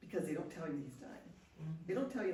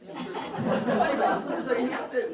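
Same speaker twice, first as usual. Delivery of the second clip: breathy laughing recovery, louder and higher; 5 semitones higher, 10 dB louder, slower than usual. Real, not acted. Speaker recovering from her own joke, laughing. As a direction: laugh it off and try to go on.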